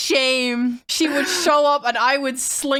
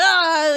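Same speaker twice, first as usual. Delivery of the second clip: loud, high "Ah!"; scare yell; 7 semitones higher, 6 dB louder than usual. Retold or imitated. Retelling a jump scare at the front door, scare yell. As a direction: burst out to startle someone. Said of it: "like, really trying to scare".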